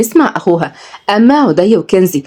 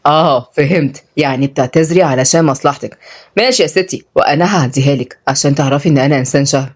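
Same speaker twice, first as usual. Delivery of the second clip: low male character voice; teasing young man; 5 semitones lower than usual. Acted. Tutor lets her apology pass, low and teasing. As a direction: drop low, forgive with mock grandeur.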